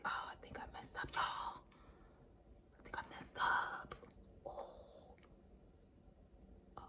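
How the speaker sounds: hushed whisper; confiding her mistake to viewers in a whisper. Real, not acted. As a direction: whisper it like a guilty secret. Confessing to viewers.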